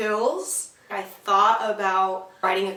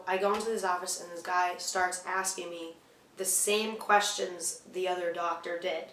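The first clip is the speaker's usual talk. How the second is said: ordinary delivery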